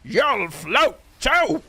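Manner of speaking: very high, slow, full-on clown shriek; monster Pennywise. Retold or imitated. Streamer does the monster version, high and slow. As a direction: hurl it out as the monster. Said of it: "full on just currying it".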